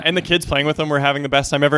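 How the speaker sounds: normal talking voice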